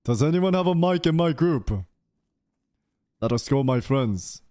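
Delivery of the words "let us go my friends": normal talking voice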